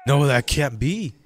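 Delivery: slow, loud protest from an indignant devil; mad devil. Acted. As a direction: play the devil objecting angrily. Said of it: "the devil gets mad".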